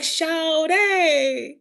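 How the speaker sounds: high, loud, drawn-out Carolina call; Carolina accent. Retold or imitated. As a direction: imitate a Carolina accent, stretched and playful. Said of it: "You got an accent and everything".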